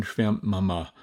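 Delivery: ordinary delivery, nothing marked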